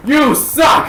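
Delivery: shouted You suck, high and loud; heckling shout. Retold or imitated. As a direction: yell it at the stage. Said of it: "Just go like".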